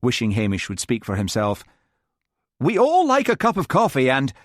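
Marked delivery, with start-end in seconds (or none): 2.6-4.3 cross. A narrator's reading. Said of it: "said John crossly".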